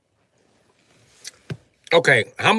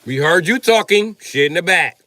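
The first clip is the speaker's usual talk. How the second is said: ordinary talk, nothing marked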